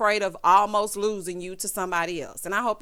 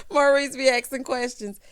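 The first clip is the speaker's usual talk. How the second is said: laughing; 4 semitones higher, 7 dB louder than usual. Real, not acted. Laughing at Maurice.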